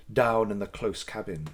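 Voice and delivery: ordinary delivery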